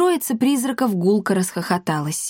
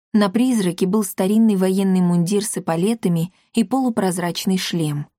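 ordinary delivery, nothing marked

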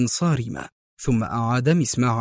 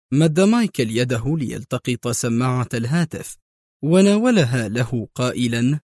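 plain reading voice

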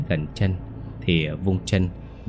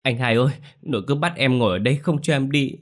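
put-on crying child; 7 semitones higher, faster than usual. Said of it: "khóc ré lên nức nở".

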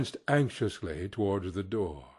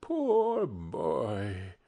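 soft-hearted, sorrowful sigh of pity; acted compassion, drawn out and falling; 3 semitones lower, 4 dB quieter than usual. A narrator's reading. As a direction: say it with tender regret. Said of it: "in pity for his former self".

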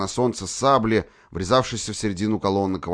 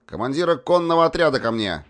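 calling out an order, louder; shouting; 7 semitones higher, 4 dB louder than usual. A narrator's reading. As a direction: shout the command across the field. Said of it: "крикнул он".

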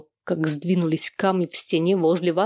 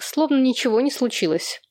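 plain reading voice

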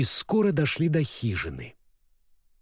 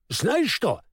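guest voice; 12 semitones higher than usual. Acted. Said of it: "посоветовал гость".

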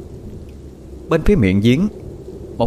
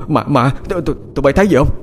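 stammering, high-pitched fright; startled, scared henchman; 6 semitones higher, faster than usual. Acted. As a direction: stammer it out in panic. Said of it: "hắn giật bắn người". (lắp bắp hoảng sợ, giọng cao)

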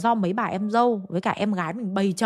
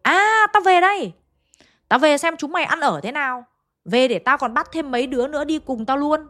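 gruff possessed scolding; 5 semitones higher than usual. Retold or imitated. Possessed medium berates the gathered family, irritably snapping. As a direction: scold like an old man's returning spirit. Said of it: "Bà gắt lên như này".

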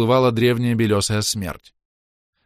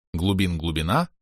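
usual speaking voice

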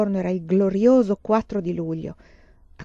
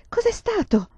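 put-on much higher, small frightened child's voice; scared little voice; 13 semitones higher than usual. A narrator's reading. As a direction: ask like a startled little girl. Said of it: "La vocina spaventata di Carrie".

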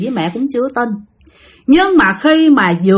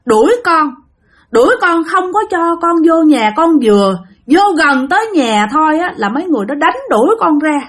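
pitiful ghost; 6 semitones higher than usual. Retold or imitated. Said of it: "nói rằng á".

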